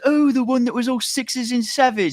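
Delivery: very high, hammed-up English voice; mock Cockney accent. Co-host quoting Austin Powers slang scene, high mock Cockney. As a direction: squeal it in theatrical Cockney.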